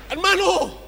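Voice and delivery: high, loud, slow cry of desperation; desperate plea. Acted. A brother pleading at another's house, desperate shout. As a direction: beg like someone at the end of their rope.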